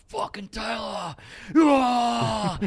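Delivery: loud, high cheering shout; crowd cheer. Retold or imitated. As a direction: yell like the stands going off. Crowd cheering the hero run.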